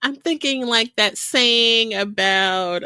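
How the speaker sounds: ordinary delivery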